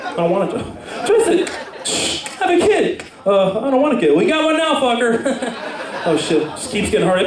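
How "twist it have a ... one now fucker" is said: Bop It toy voice. Pretend. The comedian voicing the Bop It toy for the crowd, high commands. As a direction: bark the toy's orders way up high.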